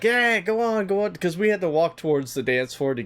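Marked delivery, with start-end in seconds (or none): 0.0-1.1 higher, gloating shoo-off, lightly voiced; mock triumphant woman. Woman thinks she won, mock gloating. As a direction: wave them off like she's won. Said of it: "and she's like".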